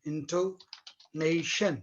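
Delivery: "Intonation" drawn out very slowly in parts; measured dictation of one term; slow, separated syllables. Shown as a demonstration. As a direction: say it slowly for the class, piece by piece. Teacher spelling out the term slowly.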